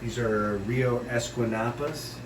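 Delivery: ordinary talk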